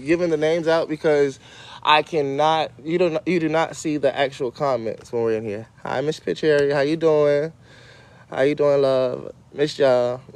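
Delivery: normal talking voice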